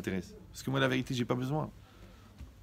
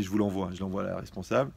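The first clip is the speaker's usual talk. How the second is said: ordinary delivery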